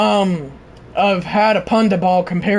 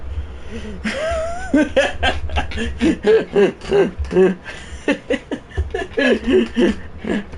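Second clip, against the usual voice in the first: long helpless laugh; 3 semitones higher, 4 dB quieter than usual. Real, not acted.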